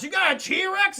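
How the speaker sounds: loud, high incredulous question; shocked disbelief. Retold or imitated. Guest reacting to the T-Rex news, loud disbelief. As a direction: blurt it back, astounded.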